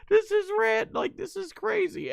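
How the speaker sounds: very high-pitched disbelief; high, incredulous fanboy reaction; amazed outburst. Retold or imitated. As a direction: squeal it in giddy amazement. Quotes own amazed reaction.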